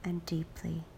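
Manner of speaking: whispered, fading breathing cue; soft spa whisper. Acted. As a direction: let it fade to a breath.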